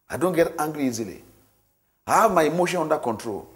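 ordinary talk

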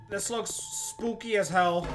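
ordinary talk

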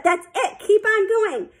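high, urging encouragement; encouraging. A narrator's reading. Coach urging Sheila to keep swimming, encouraging. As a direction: cheer them onward, pitch climbing.

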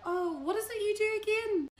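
high-pitched, feigned casual question; hammed-up innocent asking; mock-innocent. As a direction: pitch it way up, playing clueless. Acting innocent question to famous man.

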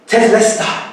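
victory cry. Acted. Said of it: "As he cried out loudly".

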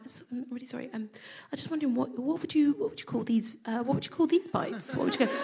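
nervously asking hostile women; timid apology. Retold or imitated. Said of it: "so I went".

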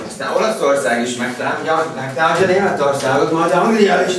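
from normal to loud, laughing and high; rising into giddy laughter; building excitement. Acted. Teacher's absurd prediction escalates.

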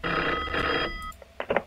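fed up with the late call; exasperated outburst. Real, not acted. Answering a creepy late-night call, exasperated whine.

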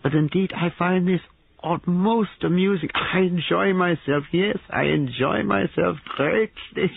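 very high, drawn-out amused foreigner; unrestrained mirth. A narrator's reading. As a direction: revel in it, voice pitched high. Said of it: "laughed without restraint".

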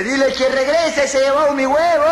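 hammed-up shout, last word held long; mock desperate cry. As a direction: call out after someone in comic agony. Man at window cries after her.

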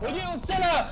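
high panicked cry; startled shriek. Real, not acted. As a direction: shriek in surprise. Sudden shooting in game.

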